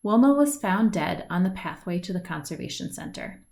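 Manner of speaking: ordinary delivery, nothing marked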